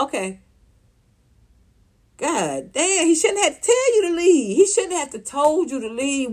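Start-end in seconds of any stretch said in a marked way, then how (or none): none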